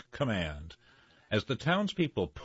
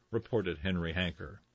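usual speaking voice

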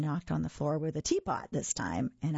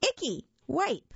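put-on higher, slow toddler voice; high toddler exclamation; amused little girl; 10 semitones higher, 3 dB louder than usual. A narrator's reading. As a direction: play a delighted toddler, high and drawn out. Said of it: "she laughed".

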